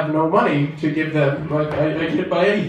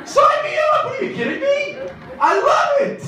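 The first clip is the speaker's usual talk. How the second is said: mock ecstatic. Comic gushing over telemarketer calls, ecstatic. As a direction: squeal with fake delight. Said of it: "mock over-eager joy".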